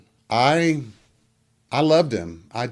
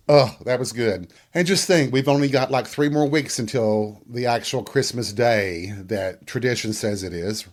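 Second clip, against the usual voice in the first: ordinary talk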